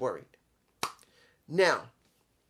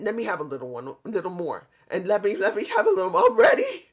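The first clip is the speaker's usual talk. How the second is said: drunk impression; 3 semitones higher, 4 dB louder, faster than usual. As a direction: ham up a tipsy man asking for more. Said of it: "He sounded like that".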